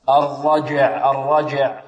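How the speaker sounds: "الرجع" slowly, the j released with a bounce; qalqala on jeem. Shown as a demonstration. Teacher showing where jeem is made, careful slow demo. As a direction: bounce the j of "الرجع" before the ayn. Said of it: "يقلقل الجيم".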